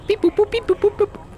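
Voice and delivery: very high squeaky robot beeps; beep boop robot. Pretend. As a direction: chirp the beeps like a toy robot. Pressing buttons on sentience module.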